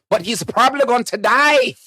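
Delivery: put-on comic Scottish; Scottish accent. Comedian predicting Harry's death, put-on Scottish accent. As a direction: deliver the warning in mock Scottish. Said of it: "was he Scottish or".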